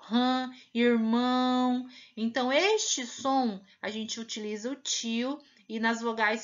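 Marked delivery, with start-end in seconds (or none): 0.0-1.9 slow rã, irmão, nasal ã and ão; nasal vowel demo. Shown as a demonstration. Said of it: "isso a gente chama de som nasal".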